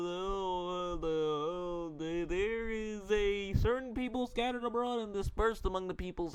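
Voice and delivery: high-pitched, stammering put-on Haman voice; stuttering yell. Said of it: "stop stuttering when you speak and talk normally. You don't gotta yell".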